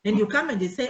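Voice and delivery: ordinary talk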